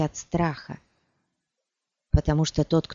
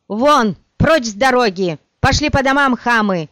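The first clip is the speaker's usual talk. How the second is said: furious high shriek; 5 semitones higher, 6 dB louder than usual. A narrator's reading. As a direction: shriek at the crowd. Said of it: "взвизгнула она".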